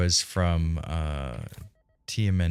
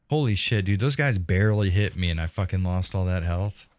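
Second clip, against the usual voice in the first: usual speaking voice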